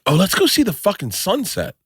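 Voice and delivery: high, eager call to see the sunset; mock-thrilled tourist; excited. Excited newcomer going to sunset.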